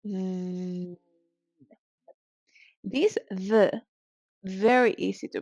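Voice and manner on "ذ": "ذ" drawn into a low, soft buzz; long voiced-th buzz on "ذ"; fly-buzz ذ. Shown as a demonstration. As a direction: drone "ذ" like a bothersome fly. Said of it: "Like the sound flies create".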